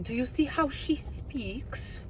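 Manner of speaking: high, hushed snobbish whisper; snooty whisper. Retold or imitated. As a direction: whisper it like a gossiping snob. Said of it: "I'm channeling you".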